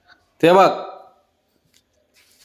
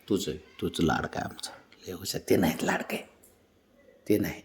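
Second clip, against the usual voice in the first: lower, much softer soothing murmur; soft lower voice soothing the sulking dog; 7 semitones lower, 13 dB quieter than usual. Real, not acted. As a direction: hush him gently, lower and softer.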